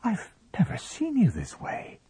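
very high, amused disbelief; taken aback but faintly amused; half shocked, half entertained. A narrator's reading. Wife reacts to husband's temper, half shocked, half entertained. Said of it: "half shocked, half entertained".

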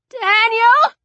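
high, loud frightened call; little boy's high, rising cry. Acted. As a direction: call out with the voice climbing. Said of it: "Jack's voice rose".

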